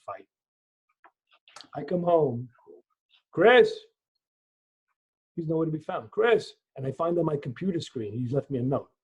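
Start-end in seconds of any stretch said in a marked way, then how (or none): 3.3-3.7 high, loud call across the house; father calling for his son through the house. Retold or imitated. 6.1-6.5 calling out. Retold or imitated. Calling for missing son.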